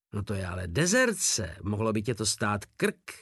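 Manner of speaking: ordinary delivery, nothing marked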